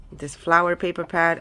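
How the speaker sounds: ordinary talk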